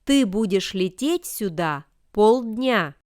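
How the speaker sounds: normal talking voice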